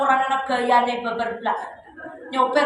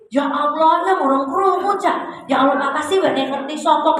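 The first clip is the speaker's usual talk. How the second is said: enthusiastic; 2 semitones higher than usual. Acted. Wife feigning excitement at husband's news, enthusiastic. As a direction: pretend to be amazed by his news. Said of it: "Gitu, antusias".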